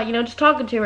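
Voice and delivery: usual speaking voice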